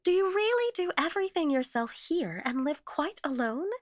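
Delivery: high, wondering visitor's question; pitched well up, politely amazed; incredulous question. Acted. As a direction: ask in high-pitched disbelief.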